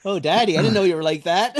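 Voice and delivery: high, teasing daughter voice; a child teasing her father, in a high mock voice. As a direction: play the shocked kid, lightly.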